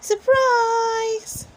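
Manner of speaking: high, loud surprise cheer; loud party surprise; surprise shout. A narrator's reading. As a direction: burst out with surprise for the birthday boy.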